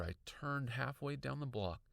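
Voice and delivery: ordinary delivery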